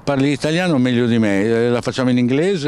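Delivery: high, loud playful greeting; excited. Real, not acted. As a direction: tease him warmly and loudly. Jovial greeting to new signing.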